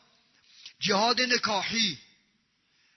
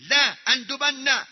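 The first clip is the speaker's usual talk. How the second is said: لا اندبن slow, raised and stressed; emphatic stress; 3 semitones higher, 4 dB louder, slower than usual. Shown as a demonstration. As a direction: lean hard and long on لا اندبن. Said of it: "نون للتأکید". (لا اندبن کشیده و مؤکد)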